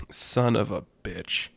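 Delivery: resigned oath, under the breath; disbelieving dismay. Acted. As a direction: drag it out in weary disbelief. Character sees the horde, exasperated.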